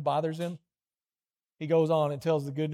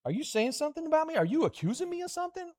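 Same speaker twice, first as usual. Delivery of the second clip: much higher mock-offended protest; defensive listener; 9 semitones higher than usual. Imagined listener accusing the preacher, mock-indignant and much higher. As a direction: play the listener taking it personally, voice climbing. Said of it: "then you're like".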